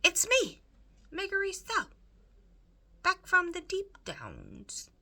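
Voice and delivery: high, loud, yelling across the kitchen; Meg shouting. A narrator's reading. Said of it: "she shouted".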